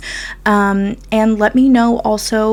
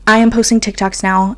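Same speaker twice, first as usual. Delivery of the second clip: usual speaking voice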